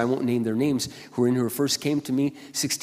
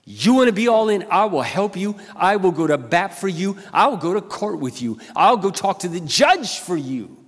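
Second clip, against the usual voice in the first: fervent promise; 5 semitones higher, 5 dB louder than usual. Retold or imitated. Pastor retells his promise.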